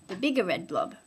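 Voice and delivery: ordinary delivery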